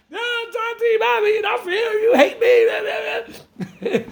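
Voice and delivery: put-on high, whining little kid; whiny child voice. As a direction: play a toddler protesting a punishment. Child voiced protesting parents.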